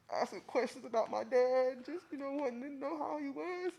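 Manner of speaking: crying. Real, not acted. Eulogy, grieving son.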